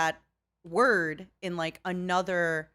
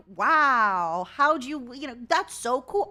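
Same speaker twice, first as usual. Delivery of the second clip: big put-on wow, raised pitch; faked enthusiasm; 3 semitones higher, 4 dB louder, slower than usual. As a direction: act impressed to be nice to a kid. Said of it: "I'm faking it".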